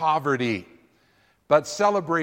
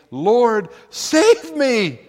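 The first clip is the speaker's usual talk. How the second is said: higher, louder plea; cry for help; 5 semitones higher, 6 dB louder, much slower than usual. Acted. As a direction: cry out as you sink. Peter sinking, cries out.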